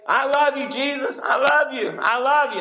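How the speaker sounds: high, gushing declaration to Jesus; gushing love. Pretend. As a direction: pour it out like an eager believer.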